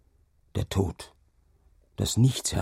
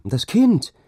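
high, loud startled exclamation; sudden high-pitched outcry; crying out; 12 semitones higher, 6 dB louder than usual. A narrator's reading. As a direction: cry out, pitch leaping up. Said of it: "rief sie".

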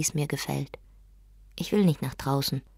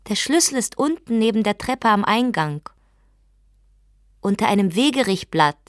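higher, louder call through the door; 5 semitones higher, 6 dB louder than usual. Acted. Wife calling to her husband through the door where the key is. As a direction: call out the hiding place clearly.

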